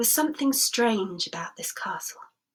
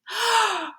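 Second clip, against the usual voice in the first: shocked gasp; 10 semitones higher than usual. Acted.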